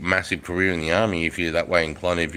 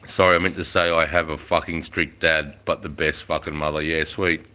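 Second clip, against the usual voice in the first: ordinary talk, nothing marked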